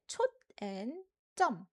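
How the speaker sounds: 점 hit hard after a short 촛; stress on second syllable. Shown as a demonstration. Teacher showing learners how to read it, second syllable 점 stressed. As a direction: break after 촛, then a strong 점. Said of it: "put an emphasis on the second syllable".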